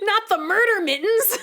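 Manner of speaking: high, laughing outcry; laughing cry. Real, not acted. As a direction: squeal it through laughter. Laughing protest at a child.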